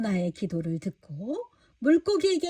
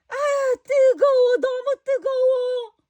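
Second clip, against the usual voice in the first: much higher, louder anguished cry; suffering from heat; 12 semitones higher, 6 dB louder, slower than usual. Acted. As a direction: moan as if the sun burns. Jonah suffers in the sun. (햇볕에 괴로워 아주 높게 외치는 요나)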